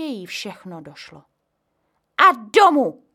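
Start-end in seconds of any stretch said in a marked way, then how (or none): 2.2-3.0 much higher, much louder order; mother ordering them home; commanding. A narrator's reading. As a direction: bark the order.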